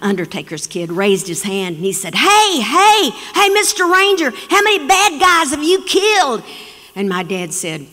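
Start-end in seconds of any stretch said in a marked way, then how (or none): 2.1-6.4 put-on high, louder child calling out; eager kid. Undertaker's kid hails the Ranger, high and eager. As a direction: wave your hand and blurt it out.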